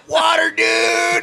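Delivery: high, slow triumphant shout; hero shout. Acted. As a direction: belt out the hero's name.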